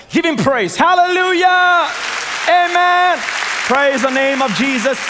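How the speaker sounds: very high, loud sustained cries; exultant praise shout. Real, not acted. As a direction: whip the congregation into praise at full voice. Calls congregation to praise.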